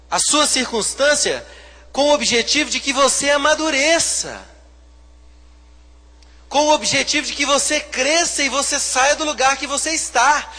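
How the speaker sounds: loud, high preaching build; fiery preaching. Acted. Pastor preaching to the church, loud and high. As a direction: preach it hard to the congregation.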